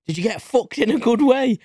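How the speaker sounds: high, mock little old lady voice; imitating sweet old lady. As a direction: pipe it up like a cheeky granny. Old lady asks about shirt.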